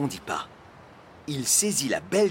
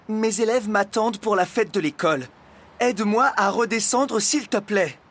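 higher, insistent appeal; reasoning plea; 8 semitones higher, 5 dB louder than usual. A narrator's reading. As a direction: plead with him to see reason. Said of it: "essaya de raisonner le dragon". (supplication raisonnable, voix haute)

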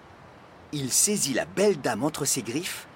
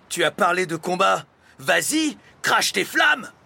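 loud, raised, building to a shout; goading challenge; 6 semitones higher, 6 dB louder than usual. A narrator's reading. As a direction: egg him on to fight. Postman goads dragon to fight. (provocation criée, de plus en plus haute)

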